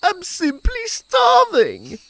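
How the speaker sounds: very high, loud and slow whine; starving grasshopper. Acted. Grasshopper pleading hunger to the ants, slow high wail. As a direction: draw it out, pitiful and dramatic. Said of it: "she said".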